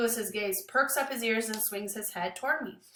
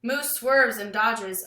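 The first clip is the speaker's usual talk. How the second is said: ordinary delivery, nothing marked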